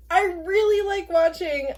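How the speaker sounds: high, giddy squeal; excited. Real, not acted. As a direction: still squeaky with delight. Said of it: "I'm so excited".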